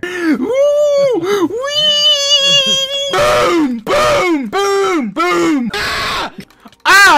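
shouting boom with each blast; loud, high, thrilled yells; excited shouting. Real, not acted. Shouting during explosions.

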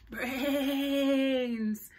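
drawn-out hungry groan; zombie moan. Acted. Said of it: "moaned the zombies".

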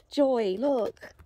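usual speaking voice

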